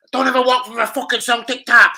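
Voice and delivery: high, loud mock Scottish threat. Rangers boy repeating the threat, loud mock Scottish voice. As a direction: bark it like a Glasgow kid.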